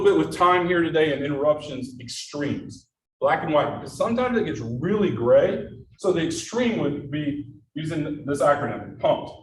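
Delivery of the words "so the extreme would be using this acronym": ordinary delivery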